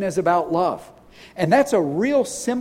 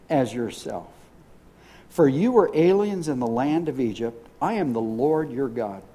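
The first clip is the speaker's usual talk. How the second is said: lower, measured reading of scripture; 4 semitones lower than usual. Acted. Pastor reading Leviticus aloud to the congregation, measured scripture reading. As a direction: read the verses lower, plainly and deliberately.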